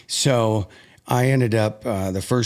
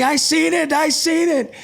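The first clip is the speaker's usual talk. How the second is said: much higher, loud thrilled squeal; excited wife; 4 dB louder than usual. Retold or imitated. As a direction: gush it like she can't contain herself. Wife runs up, almost tackles him.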